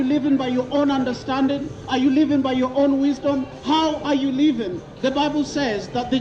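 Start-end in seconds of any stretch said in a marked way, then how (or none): none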